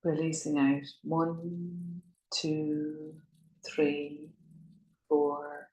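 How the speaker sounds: soft, slow, drawn-out breath counts; guided breathing meditation. Acted. Yoga teacher counting listeners' breaths in and out, hushed meditative guiding. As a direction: pace the breath gently, holding each count long and level.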